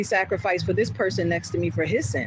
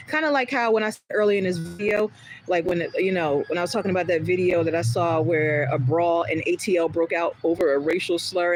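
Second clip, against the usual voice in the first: ordinary delivery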